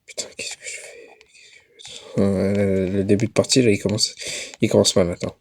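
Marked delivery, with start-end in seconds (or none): 0.1-1.1 hushed worried whisper. Real, not acted. Tense moment in horror game. 1.2-2.2 a softer repeated whisper. Real, not acted. Repeats question quieter.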